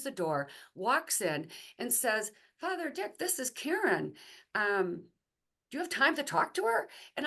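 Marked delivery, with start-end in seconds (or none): 2.6-6.9 high, bright eager introduction voice; eager nun introducing. Retold or imitated. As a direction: pitch it up like the nun presenting a newcomer. Nun introduces Karen to priest.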